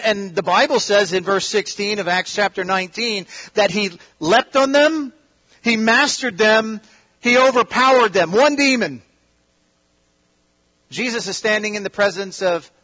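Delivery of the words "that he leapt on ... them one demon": preaching at full force. Acted. Preacher to congregation, rising to full force. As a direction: hammer each line home to the hall.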